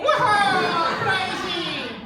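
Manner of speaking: high, loud whoop; mock cheer. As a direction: whoop like a thrill-seeker.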